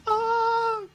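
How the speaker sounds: high strangled cry; pained yell. Acted. Wounded guard yells out, high pained cry. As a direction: yelp as the blade goes in. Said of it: "He yells out".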